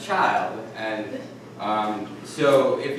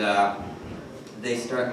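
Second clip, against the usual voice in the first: normal talking voice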